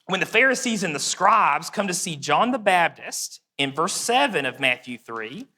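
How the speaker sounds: ordinary talk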